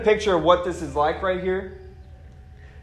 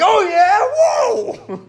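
high, loud mock battle yell from Peter; wild battle whoop; 4 dB louder, much slower than usual. Peter swinging his sword at soldiers, high yelling whoop. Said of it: "and Peter's like".

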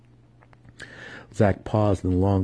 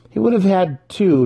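high, loud eager insistence; keen, raised voice; 12 semitones higher, 6 dB louder than usual. A narrator's reading. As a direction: press the point with relish. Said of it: "Dinan continued".

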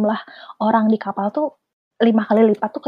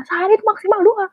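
high-pitched imitated Batak accent; 10 semitones higher than usual. Health student tells the seasick friend the dose limit in an imitated Batak accent. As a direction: lay down the rule in a high mock-Batak voice. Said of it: "terus pakai logat Batak gitu".

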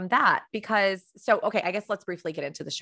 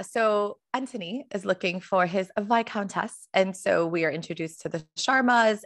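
put-on posh British accent. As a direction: savour Viscountess in a playful posh British accent. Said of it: "royal posh accents".

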